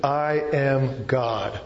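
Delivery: very slow, each word set apart; climactic declaration. Real, not acted. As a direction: land it slowly and deliberately. Preacher delivering the sermon's climactic point.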